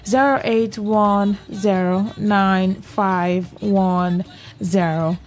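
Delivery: slow, spelled-out phone number; slow number read. Shown as a demonstration. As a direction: read each digit slowly and clearly. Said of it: "That is the number to call".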